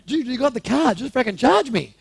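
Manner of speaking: loud, high, impatient urging; acted-out exasperated demand; urgent insistence. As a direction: push him loudly to get on with it. Acts out urging detective to charge.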